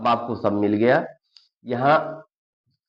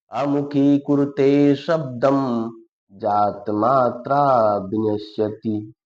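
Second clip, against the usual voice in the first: slow, loud chanting recitation of the shloka; Sanskrit verse recitation; 3 semitones higher, 3 dB louder, much slower than usual. Acted. Teacher reciting a Sanskrit shloka to students, slow and loud. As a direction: intone each line slowly and loudly, like a recitation.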